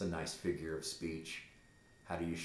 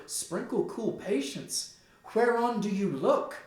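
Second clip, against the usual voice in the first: much higher and louder, frightened mother pleading; alarmed Gertrude; 7 semitones higher, 7 dB louder than usual. Acted. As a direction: beg her son to calm down. Gertrude, alarmed at her son.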